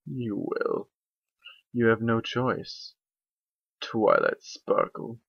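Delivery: slow hushed dark spirit voice; sinister dark voice. Acted. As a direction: tempt her from the shadows. Dark voice continues.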